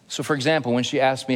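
usual speaking voice

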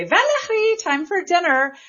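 "Valerie" said the French way, high and sung out; "Valerie" with French vowels and a high call; French pronunciation. Retold or imitated. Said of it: "that pronunciation for my name".

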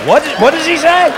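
high-pitched bewildered question; baffled. Acted. Viewer in the old country failing to understand, high baffled cry. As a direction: squawk it in confusion.

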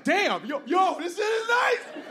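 high excited shout; loud, slow, overjoyed whoop; thrilled. Acted. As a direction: yell with delight at the legroom. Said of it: "You're like".

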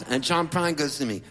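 normal talking voice

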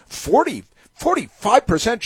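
high, hammed-up disbelief; mock astonishment. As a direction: squeal the figure in put-on shock. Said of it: "she's astonished".

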